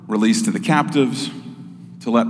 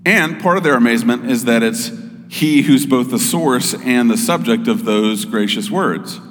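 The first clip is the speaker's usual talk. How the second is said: normal talking voice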